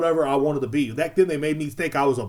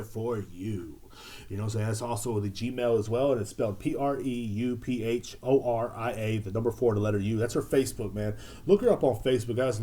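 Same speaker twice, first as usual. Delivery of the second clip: low-key, softer sponsor plug; casual ad read; 3 semitones lower, 5 dB quieter than usual. Real, not acted. Host plugging homegirl's business to the fellas, casual ad read.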